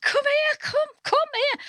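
high, slow eager beckoning; eager fan. Retold or imitated. Woman beckoning Carol to her table, high and eager. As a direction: wave her over, high and keen. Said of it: "She says".

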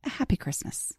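soft, put-on British accent; Caroline's British accent. Podcast host imitating her British guest's festive farewell, soft mock-British accent. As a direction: lightly mimic a British speaker, hushed. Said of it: "as Caroline would say".

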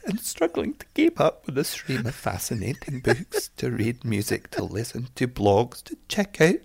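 put-on patchy Scottish accent climbing into laughter; hammed-up Scottish, ending high. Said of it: "should I do it in a Scottish accent?".

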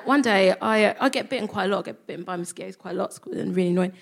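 ordinary talk, nothing marked

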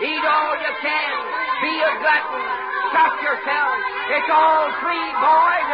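high, loud barker call; carnival barker. Acted. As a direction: shout it like a fairground barker. Coachman barks to the boys.

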